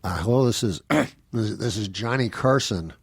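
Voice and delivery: lightly voiced talk-show host calling for help, halting and slowed; a hint of a stricken Carson, pausing and clearing his throat; Johnny Carson. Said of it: "my impression of Johnny Carson phoning nine one one".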